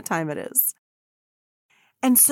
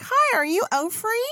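put-on much higher, bright young girl voice; chirpy little girl; 12 semitones higher than usual. Little Becky running up to Oprah on the track, high girlish voice. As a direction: pipe it up like a little kid.

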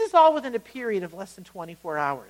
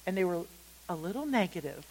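understated; 2 semitones lower, 7 dB quieter, faster than usual. Shown as a demonstration. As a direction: play it down quietly, almost as an aside. Understated setup before correcting himself.

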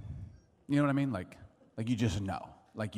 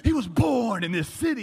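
high, loud outraged shout; exasperated, high-pitched mock fury; angry; 10 semitones higher, 6 dB louder than usual. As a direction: shout it in indignant disbelief, retelling the outrage. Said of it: "I was so angry. I'm like".

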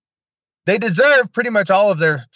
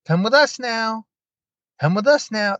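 higher, lightly hammed-up spooky chant; creepy summoning voice; 8 semitones higher than usual. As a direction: tease the creepiness with a mock ghostly call. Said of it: "one of the creepier N D Es".

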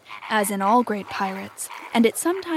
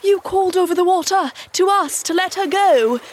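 high, loud pirate protest; 9 semitones higher, 6 dB louder than usual. A narrator's reading. Starkey defends the crew to Hook, high loud protest. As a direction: insist loudly in a raised pitch.

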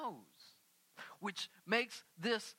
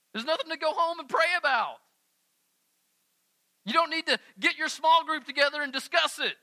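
louder, higher, urgent preaching; full-force preaching; 8 semitones higher, 12 dB louder than usual. Real, not acted. Pastor urging the congregation, preaching hard. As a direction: drive it home to the crowd.

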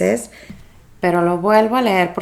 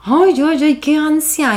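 higher, louder mocking voice of an irritated friend; exasperated friend, pitched up and loud; annoyed friend; 8 semitones higher, 3 dB louder than usual. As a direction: whine it like a jealous friend. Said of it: "esta amiga como que se enojaba y me decía".

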